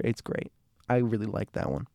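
ordinary delivery

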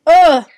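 high, loud "ugh"; loud groan. Real, not acted. Host gushing about a show before wrapping up, loud groan. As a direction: let out a sudden loud groan.

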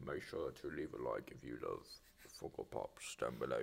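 low, soft British promo voice; hushed British-accented sign-off. Acted. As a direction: read the plug in a quiet, deep British accent. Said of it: "This is still a British episode".

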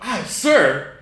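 high loud bright "Hi, sir"; loud chirpy greeting. Retold or imitated. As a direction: greet her eagerly and loudly. Repeating the mistaken greeting.

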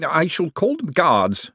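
slow, high-pitched firm announcement. A narrator's reading. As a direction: put your foot down, measured and formal. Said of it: "said Mister Bent firmly".

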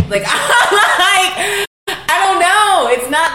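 high laughing complaint, slowed at the end; giggly high protest. Real, not acted. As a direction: laugh it off while griping.

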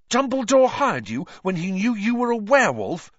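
put-on high, loud, incredulous boy; gasping disbelief. A narrator's reading. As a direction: gasp the question out, aghast. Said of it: "Ron gasped".

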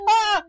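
high, loud mock scream; startled yelp, acting out dad; imitated startled dad scream. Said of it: "my dad would flip out".

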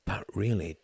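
ordinary delivery

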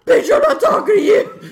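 very loud, high demon shriek; hammed-up demonic screaming. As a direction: yell it as a pretend demon. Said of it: "demon boy is like".

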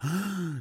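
a sharp high imitated gasp before the reveal; mock gasp. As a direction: gasp like a shocked owl. Owl's dramatic reveal.

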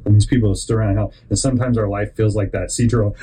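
usual speaking voice

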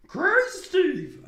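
high, drawn-out shout; slow, loud, high-pitched whoop; catchphrase yell. Retold or imitated. Quotes iCarly character's catchphrase shout.

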